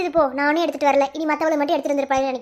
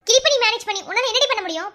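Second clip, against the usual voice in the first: raised, high-pitched retort; scornful scolding; 7 semitones higher than usual. Acted. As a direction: shoot down a friend's protest. Friend scornfully dismisses weak protest.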